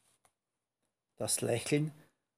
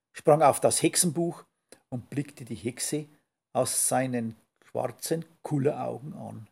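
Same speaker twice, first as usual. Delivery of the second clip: plain reading voice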